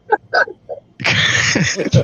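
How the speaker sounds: breathless high wheeze of laughter; can't hold in the laugh; wheezing laugh. Real, not acted. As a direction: silent wheezy giggle. Laughing at his own story.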